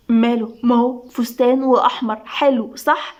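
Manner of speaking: ordinary delivery, nothing marked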